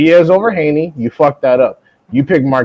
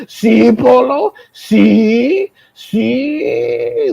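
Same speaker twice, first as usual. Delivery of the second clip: much higher, slow gloating taunt; mock gloating; 9 semitones higher, much slower than usual. Mocking a pundit who gloats over a correct pick. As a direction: rub it in like a sore winner. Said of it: "You didn't see me go".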